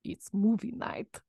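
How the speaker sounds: slow, soft mock-Russian accent; tentative Russian accent; Stalin accent. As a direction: announce it slowly in a fake Russian accent. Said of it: "how would Stalin say was movie night".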